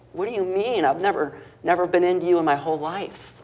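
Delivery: high-pitched, incredulous mock protest; retold disbelief. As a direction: imitate a baffled mother, pitch jumping up. Mother's retold retort to God.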